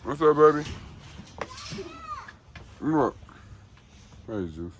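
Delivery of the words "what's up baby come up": higher, louder call; calling the dog. Real, not acted. Owner calling the dog into the truck, loud call.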